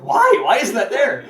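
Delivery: high, loud disbelieving why; shocked why; baffled why. Retold or imitated. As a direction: ask it in loud confusion. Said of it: "I'm like".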